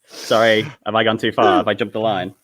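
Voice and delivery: ordinary talk, nothing marked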